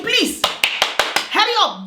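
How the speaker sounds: mock-sweet dare to call the police; sarcastic dare. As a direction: drag it out, daring them. Defiantly daring them to call police.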